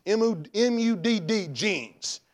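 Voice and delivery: very slow, spelling M U D D; spelled out slowly. Real, not acted. Preacher mocking the boy's girl jeans, slow. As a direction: spell each letter deliberately. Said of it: "spelling out the brand".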